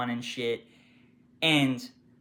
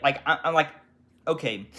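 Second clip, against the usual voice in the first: usual speaking voice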